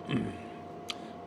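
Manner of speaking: quick rough throat clear. Real, not acted. Clears throat mid review.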